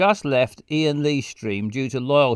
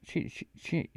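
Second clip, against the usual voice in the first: whispered tries; 3 semitones higher, 9 dB quieter than usual. Real, not acted. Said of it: "I don't know".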